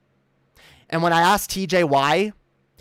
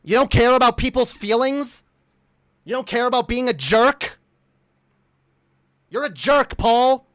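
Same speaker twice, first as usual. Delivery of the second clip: raised, higher, indignant challenge; angry confrontation; 5 semitones higher, 4 dB louder than usual. Real, not acted. Streamer confronts Paul about being a jerk, angrily. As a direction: fire accusing questions at an opponent.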